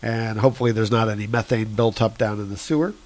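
ordinary talk, nothing marked

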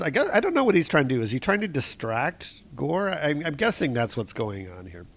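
ordinary delivery

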